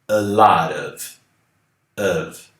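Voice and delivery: slow model. Shown as a demonstration. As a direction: say A lot of slowly with a tapped T. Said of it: "Um lote de".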